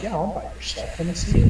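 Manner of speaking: high, sly villager pretending to buy; a lightly voiced sly townsman; mock villager voice. Friend retelling a robbed villager baiting the thief, sly high voice.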